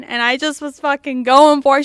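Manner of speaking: loud excited exclamation; shouting. Real, not acted. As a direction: belt it out in the telling.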